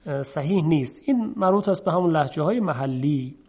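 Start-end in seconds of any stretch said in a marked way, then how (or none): none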